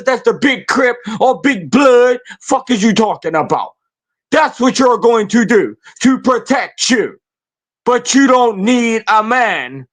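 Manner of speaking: normal talking voice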